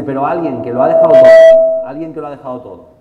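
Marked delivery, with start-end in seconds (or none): 0.0-1.8 much louder, forceful opening; impassioned loud declaration. Retold or imitated. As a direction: proclaim it loudly to the room. Dean's impassioned defense. (declaración apasionada en voz muy alta)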